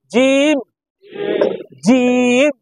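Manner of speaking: jim held long, firm from mid-tongue. Shown as a demonstration. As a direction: press the j hard and stretch jim. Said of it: "জিব্বার মাঝখান থেকে শক্ত হবে". (জিম শক্ত করে টেনে বলা)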